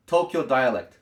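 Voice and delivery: ordinary delivery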